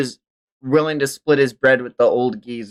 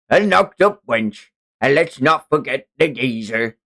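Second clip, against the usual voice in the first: sneering pirate captain, raised pitch; slaver captain; 4 semitones higher than usual. Acted. Streamer reading manga as the slaver captain, gloating.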